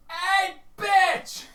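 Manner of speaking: screeching cardinal shout; high bird shout. Acted. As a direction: screech it up at the sky.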